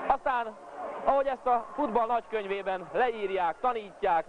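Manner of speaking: high, thrilled goal recap; excited hungarian sports commentary. Acted. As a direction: still buzzing after the goal. Commentator still thrilled after goal.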